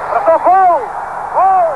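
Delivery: loud, high goal shout; excited hockey goal call. Real, not acted. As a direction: yell the goal out. Goal scored live.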